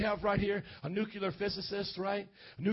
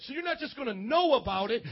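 ordinary talk

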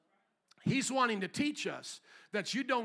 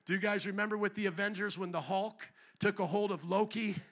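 ordinary delivery

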